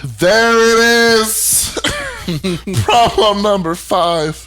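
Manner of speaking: high, loud, drawn-out outrage; outraged exclamation. Real, not acted. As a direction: cry it out in disbelief.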